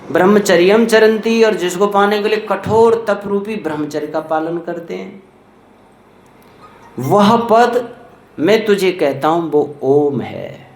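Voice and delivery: ordinary talk, nothing marked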